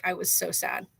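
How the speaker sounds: normal talking voice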